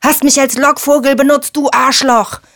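high-pitched furious accusation; enraged roar. A narrator's reading. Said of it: "brüllte Erkan".

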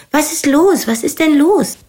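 high, urgent repeated calls; drängendes Rufen. A narrator's reading. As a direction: call out anxiously, pitch jumping up. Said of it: "Nina rief ständig". (aufgeregt und drängend rufen)

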